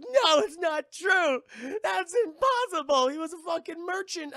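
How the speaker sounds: high, loud, slow sobbing protest; crying denial. Retold or imitated. Will refusing to accept his father was a pirate, crying shout. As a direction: wail it out, refusing to believe.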